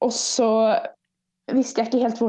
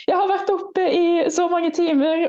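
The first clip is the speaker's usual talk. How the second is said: retold teary crying; 7 semitones higher than usual. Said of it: "begynte jeg bare å grine".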